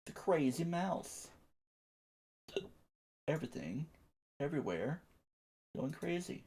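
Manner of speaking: very soft, hushed narration. Pretend. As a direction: drop almost to a whisper.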